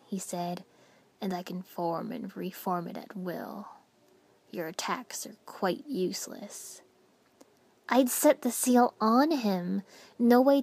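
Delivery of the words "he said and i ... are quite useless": low, soft, detached menace; cold calm villain. A narrator's reading. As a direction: speak down with icy calm.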